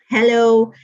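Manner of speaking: usual speaking voice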